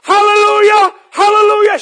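very high, full-voiced praise shout; shouted hallelujah. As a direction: shout praise at the top of your voice. Acts out his shouted praise.

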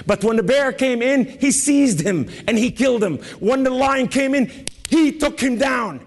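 high, driving sermon storytelling; impassioned preaching. Real, not acted. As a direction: build the tale excitedly from the pulpit.

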